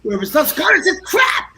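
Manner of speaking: high mock Scottish bluster rising to a shout; imitating Jack. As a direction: ham up a proud Scot. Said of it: "That's him".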